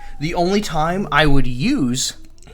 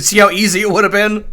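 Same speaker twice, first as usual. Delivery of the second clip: loud, raised-pitch rhetorical question; emphatic imitation of a scolding victor; retold pointed challenge; 6 semitones higher, 6 dB louder, faster than usual. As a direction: act out driving the point home. Retold uncle pressing his point.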